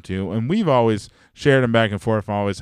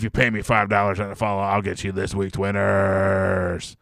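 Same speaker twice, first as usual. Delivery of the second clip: scammy picks seller; 4 semitones lower than usual. Retold or imitated. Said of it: "those kind of slubs".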